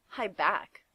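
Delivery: plain reading voice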